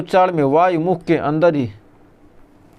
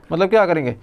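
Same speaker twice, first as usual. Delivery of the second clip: normal talking voice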